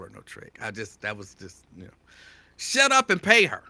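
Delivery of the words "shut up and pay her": high, loud sister's scold; mock yell. Sisters telling Tiger Woods to pay, high loud scold. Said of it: "That's what y'all would say".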